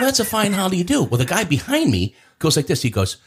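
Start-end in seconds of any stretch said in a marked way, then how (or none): 0.0-1.0 high, mock-offended quip; pitched-up sarcastic complaint. Scolding his buddy who pretends not to know him, sarcastic and high. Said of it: "I'm going".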